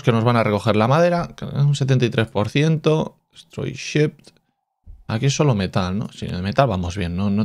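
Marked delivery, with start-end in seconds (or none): 3.3-4.3 slow whispered reading. Real, not acted. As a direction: breathe the label out slowly. Reads game label softly.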